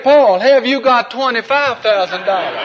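high, loud, eager question; Michelle's voice. Acted. Michelle asking Paul about his money, high and loud. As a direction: ask it brightly, as a punchline. Michelle lowers her price.

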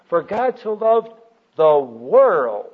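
WORLD punched loud and drawn out; stress on WORLD. Shown as a demonstration. Teacher showing how to meditate on a verse, stressing WORLD. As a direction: build slowly to a big, stretched world. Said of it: "I like to emphasize different words".

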